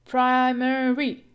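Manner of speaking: Primary slowly stretched out, loud; slow emphatic teacher's Primary; primary stress demo. Shown as a demonstration. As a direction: draw out Primary with firm, loud beats. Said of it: "We put the stress or the primary stress here".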